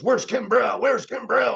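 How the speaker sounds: half-hammed boss yelling for Kimbrell; mock screaming boss. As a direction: imitate a maniac boss shouting the question. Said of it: "this maniac guy is screaming at us".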